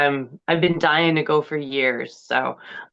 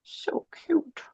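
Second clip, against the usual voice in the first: whispered; 8 semitones higher, 7 dB quieter than usual. Real, not acted. Cooing over the cat.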